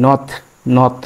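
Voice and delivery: নথ ending in a crisp t; pronunciation demo. Shown as a demonstration. Teacher demonstrating final-t নথ to the class. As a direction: cut নথ off on a clean final t. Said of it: "থ উচ্চারণ হবে".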